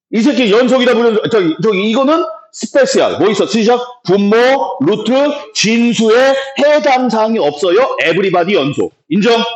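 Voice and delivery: high-pitched recitation, item by item; demonstrated reading aloud. Shown as a demonstration. Teacher drills students on reading it, high recitation. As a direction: rattle off the checklist in a high classroom chant. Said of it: "이렇게 읽는 거야".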